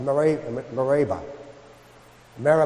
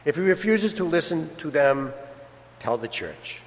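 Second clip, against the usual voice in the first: gospel reading; 4 semitones lower, 6 dB quieter than usual. Acted. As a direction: read it reverently, unhurried, to the congregation. Priest proclaims the Gospel.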